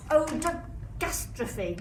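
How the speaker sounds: ordinary delivery, nothing marked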